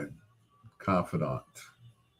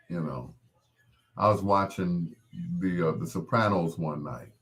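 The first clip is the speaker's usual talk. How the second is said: ordinary talk, nothing marked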